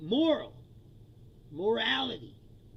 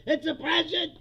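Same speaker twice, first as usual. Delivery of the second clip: mock outrage; 6 semitones higher, 6 dB louder than usual. Sarcastic mock cry of oppression.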